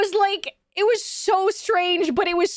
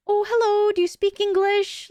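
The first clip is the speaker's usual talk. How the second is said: higher, imitated bashful man; overly shy stranger; 5 semitones higher than usual. Shy stranger approaching tourists to ask about English, imitated bashfully. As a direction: mimic a timid guy approaching strangers. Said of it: "overly shy".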